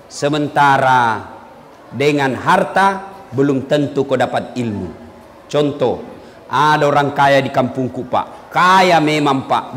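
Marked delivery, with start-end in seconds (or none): none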